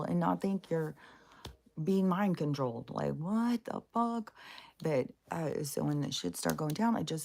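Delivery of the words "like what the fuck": incredulous. Real, not acted. Disbelief at Bible highlighting.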